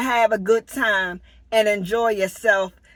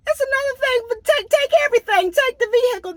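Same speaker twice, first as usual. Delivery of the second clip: pitched way up, urgent; alarmed high pitch; 12 semitones higher, much faster than usual. Real, not acted. Vlogger warning viewers of theft, high urgent voice. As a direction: spike up with alarm at the thought. Imagining thieves stripping everything.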